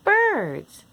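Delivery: ordinary delivery, nothing marked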